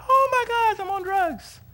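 high-pitched, loud mock panic; freaking out. Preacher retelling the camper's out-of-body shock, comic panicked squeal. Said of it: "So he's freaking out".

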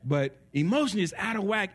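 usual speaking voice

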